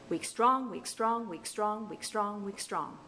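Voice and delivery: "weak STRONG" five times in even rhythm, fading toward the end; iambic pentameter beat. Shown as a demonstration. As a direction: stress each "strong", skim each "weak". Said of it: "what a line of iam".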